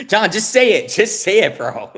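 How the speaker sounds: egging a teammate on while cracking up; laughing and urging John to answer; laughing plea. Real, not acted.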